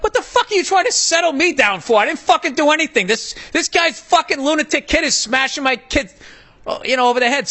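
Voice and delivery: high, heated retold rant at the other dad; fired-up angry outburst, pitched high. Angry dad snapping at the man who calmed him. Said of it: "I'm like".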